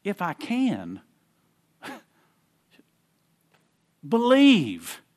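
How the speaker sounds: pause, then land "believe." as a bold command; pause before believe. Shown as a demonstration. Preacher repeating the moved comma to the congregation, emphatic "believe.". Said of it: "We could say it like this".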